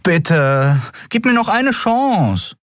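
high, drawn-out begging; pleading. A narrator's reading. Miko begs Nelen for another chance, pleading. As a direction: beg desperately, pitch climbing.

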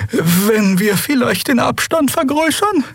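high, slow, tentative voice; nervous crew member. A narrator's reading. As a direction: suggest it timidly, high and slow. (hohe, zaghafte, langsame Figurenstimme)

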